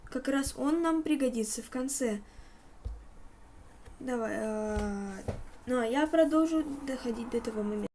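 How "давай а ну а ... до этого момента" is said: ordinary talk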